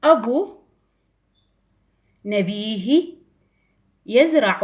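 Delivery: word-by-word read-aloud; teacher reading a sentence to children; slow model sentence. Shown as a demonstration. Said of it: "ننتقل إلى جملة".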